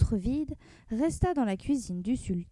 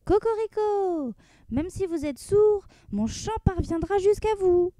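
rooster crowing; 7 semitones higher, 3 dB louder than usual. Acted. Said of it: "on entendit à nouveau".

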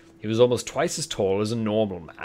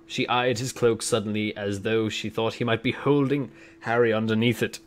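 usual speaking voice

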